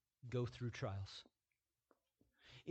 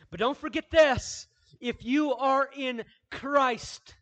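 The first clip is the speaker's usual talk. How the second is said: loud, high, ringing pulpit voice; preaching at full force; 12 semitones higher, 12 dB louder than usual. Acted. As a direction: proclaim it to the whole church. Preacher rising to full force again.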